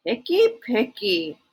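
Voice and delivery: grumbling picky picky; complaining. A narrator's reading. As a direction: mutter it with disappointment. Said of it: "Herbert complained".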